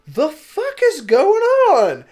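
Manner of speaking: high, slow and loud, utterly bewildered; incredulous shriek, dragging the words; baffled outburst. Retold or imitated. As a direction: squeal it out like you can't believe your eyes. Sees more gashed people, baffled.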